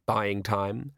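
plain reading voice

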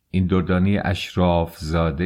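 ordinary delivery

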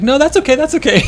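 put-on high, loud quick kid backing down; hasty little boy. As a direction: say it like a kid dodging the walk. Son voiced by father.

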